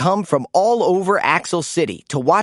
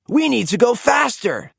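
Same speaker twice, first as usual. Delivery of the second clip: higher, urgent call to speed up; cried urgently; 7 semitones higher than usual. A narrator's reading. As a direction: push the team on as the road cracks. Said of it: "cried Blaze".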